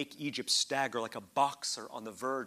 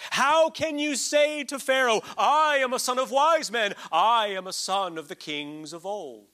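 preaching scripture; 8 semitones higher, 10 dB louder than usual. Acted. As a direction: declaim each word like a prophet. Reading scripture with full force.

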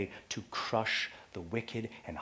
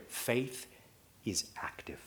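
whispered emphasis; 7 dB quieter than usual. Real, not acted. Key point dropped low.

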